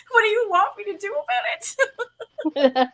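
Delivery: high, slow, half-crying laugh; mock despair through laughter; exasperated laughing whine. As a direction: plead helplessly while cracking up. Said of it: "It's like".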